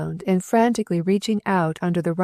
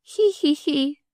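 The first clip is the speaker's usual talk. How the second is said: high, hiccuping snicker; 9 semitones higher, slower than usual. A narrator's reading. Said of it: "snickered ... through her hiccups".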